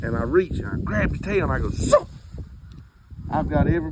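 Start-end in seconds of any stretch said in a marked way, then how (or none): 1.7-2.1 zoop sound effect. Retold or imitated. Ripping fur off the tail.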